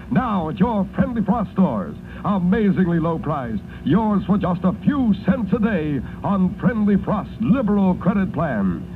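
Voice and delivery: upbeat radio pitchman read; chipper 1950s ad. Acted. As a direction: sell it cheerfully to the moms listening. Live radio ad for washer.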